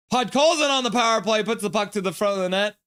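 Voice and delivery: urgent high hockey call; excited play-by-play. Real, not acted. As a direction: voice jumps as the chance builds. Live call of a scoring chance.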